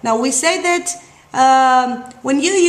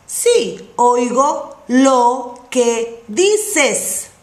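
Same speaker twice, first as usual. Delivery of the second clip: slow model answer; much slower than usual. Shown as a demonstration. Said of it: "teacher models the answer slowly".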